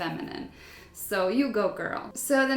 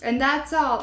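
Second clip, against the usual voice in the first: ordinary talk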